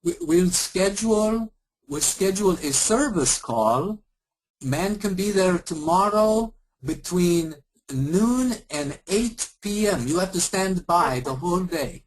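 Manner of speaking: slow, high put-on Eastern European accent; mock agent spelling out the service window in an Eastern European accent; hammed-up Eastern European accent, slow; bad Eastern European accent, slow. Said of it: "really bad Indian and Eastern European accent".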